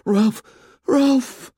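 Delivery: high, loud cry as if in pain; anguished cry. A narrator's reading. Simon crying out to Ralph as though hurt. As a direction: call out as if hurt. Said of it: "cried out as though he had hurt himself".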